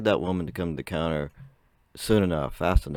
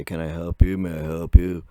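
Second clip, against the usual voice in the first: mock clerk. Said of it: "and say".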